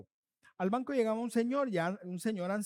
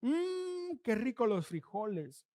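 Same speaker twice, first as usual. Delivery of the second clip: sarcastic praise; slower than usual. Acted. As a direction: feign delight at the food. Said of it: "el tono de la voz lo dice todo".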